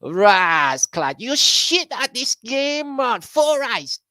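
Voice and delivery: squeaky heckler mocking a bad player; high taunting voice. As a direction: jeer at him from way up high.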